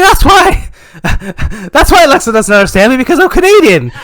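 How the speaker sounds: loud, high, laughing revelation; excited realisation. Acted. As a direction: it suddenly all makes sense, blurt it out. Acts out his sudden realisation.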